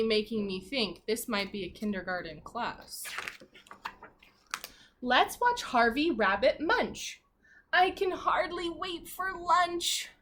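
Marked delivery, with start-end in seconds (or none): 5.0-10.1 slow, sing-song reading of the rhyme; read-aloud to children. Acted. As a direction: read it slowly to a class of little kids. Reading picture book aloud.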